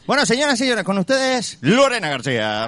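high, grand show host introduction; announcer voice. Acted. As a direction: announce the guest like a gala host.

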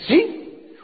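sharply high, rising "really?"; astonished question. Retold or imitated. Friend reacting to the speaker's founder claim, astonished question. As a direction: shoot the pitch right up in mock surprise. Friend's surprised reply acted out.

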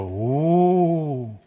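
loud, high hoot through a cupped hand. A narrator's reading. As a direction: hoot like an ancient call. (ulular alto con la mano en la boca)

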